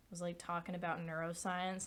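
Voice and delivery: usual speaking voice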